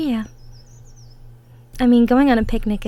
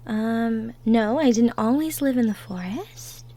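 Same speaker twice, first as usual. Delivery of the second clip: ordinary delivery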